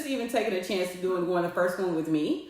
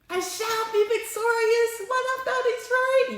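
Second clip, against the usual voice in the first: much higher, slow and triumphant; proclaimed high; 14 semitones higher than usual. Shown as a demonstration. Alone after the call, declaring victory high and slow. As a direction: announce victory in a lifted, far-off voice. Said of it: "But I can".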